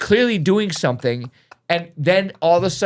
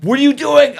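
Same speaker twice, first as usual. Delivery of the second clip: shocked outburst; 10 semitones higher, 7 dB louder than usual. Retold or imitated. Teacher reacting to students' VR scenario.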